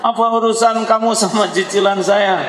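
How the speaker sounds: shrill-free but high mock indignation; offended retort. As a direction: play the insulted lady firing back. Offended woman retorts in story.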